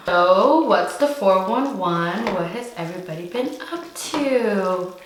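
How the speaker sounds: playful singsong movie line; drawn-out, exaggerated quoted greeting; movie quote. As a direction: recite a film line with big swooping pitch. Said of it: "Quote that movie".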